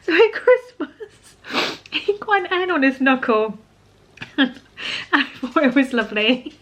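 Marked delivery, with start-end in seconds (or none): 0.0-3.6 real tears breaking through the words; choked-up, tearful and drawn out; crying. Real, not acted. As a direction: voice cracking high and slow through tears. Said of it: "I've cried twice in this video".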